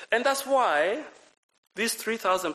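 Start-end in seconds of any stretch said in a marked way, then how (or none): none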